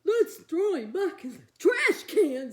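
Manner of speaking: loud, laughing monster dad; Mister Monster. Acted. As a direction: boom it with a cackle. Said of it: "said Mister Monster".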